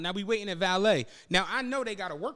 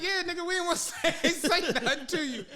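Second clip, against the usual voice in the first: high voice broken by laughs; laughing explanation; 11 semitones higher than usual. Retold or imitated. Friend admitting the car surprise was planned, laughing as he explains. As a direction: chuckle through the imitated confession.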